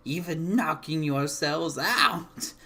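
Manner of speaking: put-on loud, taunting old hag voice. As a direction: gloat over them like a witch. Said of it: "The hag cackles and".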